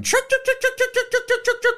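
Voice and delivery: high clucking "Chuk, chuk, chuk" like birds; bird chuk calls. Retold or imitated. Imitating the birds as Sean Connery flaps his umbrella, high clucks. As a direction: squeak out the bird chuks far up high. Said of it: "with the birds going".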